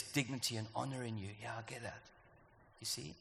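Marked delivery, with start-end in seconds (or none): none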